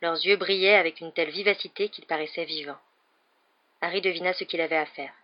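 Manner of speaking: ordinary delivery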